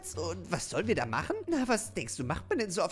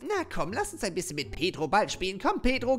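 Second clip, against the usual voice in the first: ordinary talk, nothing marked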